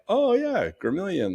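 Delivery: Oh leaps very high, then Gramillion; delighted recognition. Retold or imitated. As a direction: sound glad to know the name. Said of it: "people out here be like".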